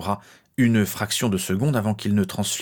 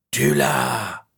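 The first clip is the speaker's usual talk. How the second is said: low, drawn-out sinister hiss; eerie low inner voice; 6 semitones lower than usual. A narrator's reading. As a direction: drop low and hiss it insidiously. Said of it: "la voix sifflante et lancinante".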